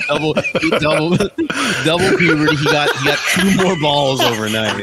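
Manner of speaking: cracking up mid-sentence; laughing. Real, not acted. Laughing about double puberty voice.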